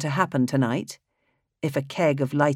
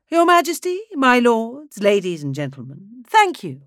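much higher, sing-song theatrical conductor; high, mellifluous voice; 8 semitones higher, 5 dB louder than usual. Acted. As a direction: croon the greeting like a showman. Conductor thanks audience.

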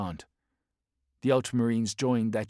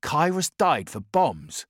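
slow, higher and louder, outraged; angry disbelief; 8 semitones higher, 7 dB louder, slower than usual. Acted. Caprico outraged that his brother died for bombs. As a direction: spit out the question slowly. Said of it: "Caprico stiffened".

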